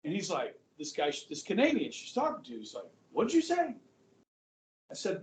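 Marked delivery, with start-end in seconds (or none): 3.1-3.8 higher, mock-incredulous question; retold taken-aback question. Canadian questioning the teacher about a term, mock taken aback. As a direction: imitate someone caught off guard.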